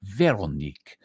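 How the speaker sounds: Veronique with a hammed-up French accent, vair-o-NEEK; amateur French accent on Veronique; mock French. Shown as a demonstration. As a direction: say Veronique like a Frenchman from an old film. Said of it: "Trying to say it sound French".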